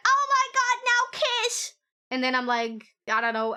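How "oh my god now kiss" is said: mock fangirl squeal. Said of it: "I'm not like".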